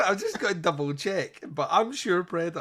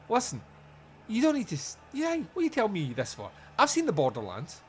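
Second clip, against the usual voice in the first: high-pitched, protesting inner voice; mock exasperated retort; 7 semitones higher than usual. Listener silently answering the lecturing Reverend, mock exasperated. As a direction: interrupt with comic impatience.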